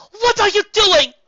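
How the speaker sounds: slow loud high demand; angry shout. A narrator's reading. Rarity angrily demands an answer from Twilight. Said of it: "Rarity roared".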